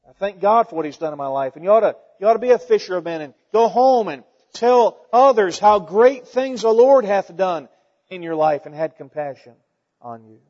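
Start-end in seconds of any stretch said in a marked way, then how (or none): none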